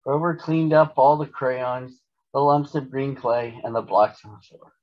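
plain reading voice

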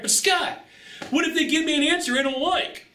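high whining question; whiny viewer. Retold or imitated. Viewer whining worried question at coach, high whine. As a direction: pitch it up like a nervous student pleading. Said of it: "And you're like".

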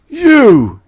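high, loud shriek. A narrator's reading. Chowderhead shrieks at the narrator in rage. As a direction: shriek the word at him. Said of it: "He shrieked".

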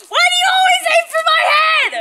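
high loud protesting shout. Real, not acted. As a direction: yell it at her. Sprayed in the face.

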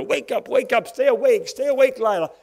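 urgent calling out. Retold or imitated. Nurse keeping girl awake, retold.

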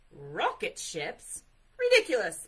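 loud scorn, rising to an outburst. A narrator's reading. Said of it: "Bear's voice was getting louder".

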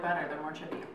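chewing while talking; snacking mid-sentence; mouth partly full. Real, not acted. Seen 0: Mostly talks; puts a chip to her mouth near the end.

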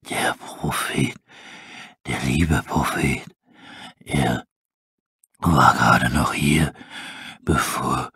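very low, slow breathy wolf rasp; a dying wolf barely whispering; weak wolf. Acted. Starving wolf asking about his prophet, faint rasp.

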